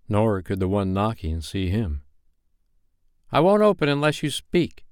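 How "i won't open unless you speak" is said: high, insistent call through a door; firm ultimatum, pitched up; boy's cry. Acted. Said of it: "Peter cried".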